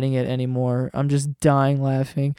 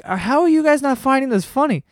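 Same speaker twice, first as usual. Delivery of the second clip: high-pitched disbelieving plea; acted-out bewildered disbelief; incredulous; 9 semitones higher than usual. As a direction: ask in high, baffled amazement. Acts out disbelief at other passengers.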